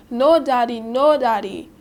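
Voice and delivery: loud pleading cry of no, daddy; screaming child. Acted. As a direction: scream it out, begging her father to stop. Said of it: "begin to scream at the top of her voice".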